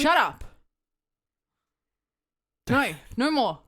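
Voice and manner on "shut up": loud, sharp, higher "shut up"; real exasperated snap at the co-hosts; fed-up shout. Real, not acted. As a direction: cut them off, done with it.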